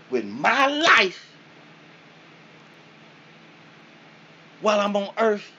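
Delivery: high, loud, slow preachy rebuke; the soul berates him in a high booming voice; scolding spirit. Retold or imitated. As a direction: lecture him like a disappointed spirit.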